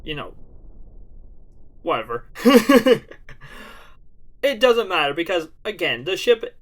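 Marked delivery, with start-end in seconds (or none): 2.3-4.4 big hearty laugh; loud laugh. Real, not acted. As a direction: burst out laughing. Burst of laughter.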